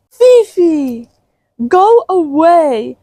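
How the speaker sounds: high, loud, slow go away; hammed-up high shout at a friend; dramatic shooing. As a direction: shoo her off, drawn out and high.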